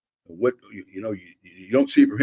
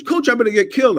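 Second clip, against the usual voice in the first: high, loud mock complaint; imitated worried quarterback, high and loud; retold player's protest; 11 semitones higher, 6 dB louder than usual. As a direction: plead with the coach, pitched way up. Retells player protesting to coach.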